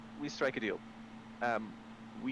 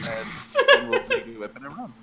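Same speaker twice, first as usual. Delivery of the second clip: laughing through the words; giggling as the plan comes out; 11 dB louder than usual. Real, not acted.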